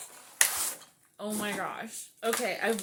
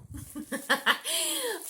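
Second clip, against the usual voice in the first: high burst of laughter; 6 dB louder than usual. Real, not acted. Laughs at note.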